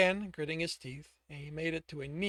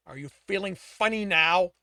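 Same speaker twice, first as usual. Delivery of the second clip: angry taunt; 4 semitones higher, 12 dB louder than usual. Acted. Torturer taunting.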